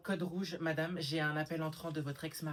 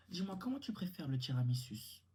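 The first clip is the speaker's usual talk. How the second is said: usual speaking voice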